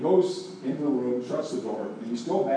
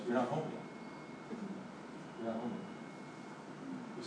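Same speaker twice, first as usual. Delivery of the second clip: quiet voice of God; 15 dB quieter than usual. Retold or imitated. The Lord's quiet answer.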